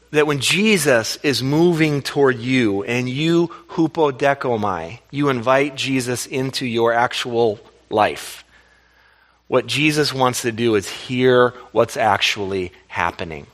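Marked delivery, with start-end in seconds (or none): none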